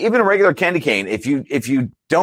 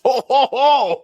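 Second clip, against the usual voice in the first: Santa laugh; 8 semitones higher, slower than usual. Retold or imitated. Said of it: "the actual Santa laugh".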